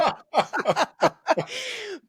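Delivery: high, bursting laugh; real laugh. Real, not acted. Laughs at own joke book title.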